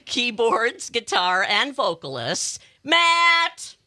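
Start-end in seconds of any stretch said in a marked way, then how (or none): 2.8-3.8 loud, high call of name. Real, not acted. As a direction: call him over. Calling out to band member.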